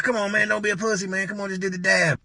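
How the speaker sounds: high, pinched-back peer pressure; goading. Retold or imitated. Dealer pressuring him to dab.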